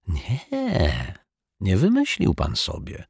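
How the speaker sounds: calm. Acted. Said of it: "ze spokojem".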